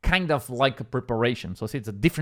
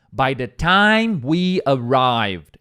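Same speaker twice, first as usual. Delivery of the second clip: slow, louder example line; slow model phrase; 5 dB louder, much slower than usual. Shown as a demonstration. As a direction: read it slowly as a model for the class.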